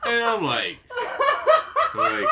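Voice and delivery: high and loud, cracking up; helpless real laughter; laughing. Real, not acted. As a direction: keep restarting through the laughter. Can't finish for laughing.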